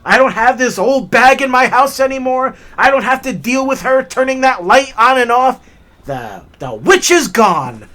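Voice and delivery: loud, high triumphant gloat; gloating grandfather. Retold or imitated. Grandfather gloating that the mother-in-law is gone, loud triumphant glee. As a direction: crow over being rid of the old bag. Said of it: "He thought".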